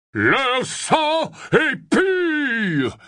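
very slow, weighty, final pronouncement; cutting verdict. A narrator's reading. Harry as Runcorn settling the matter, curt and final. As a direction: lay it down like a judgment. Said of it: "trancha Harry".

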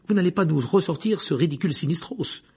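high, loud, scornful protest; exasperated exclamation. A narrator's reading. Hermione challenging the professor's prophecy, exasperated. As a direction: burst out, fed up. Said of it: "s'exclama Hermione".

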